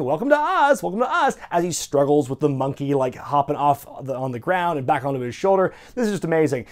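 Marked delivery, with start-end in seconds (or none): 0.0-1.4 high, repetitive mock wizard voice; high-pitched hammy welcome; Kevin Sullivan. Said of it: "Sullivan keeps saying".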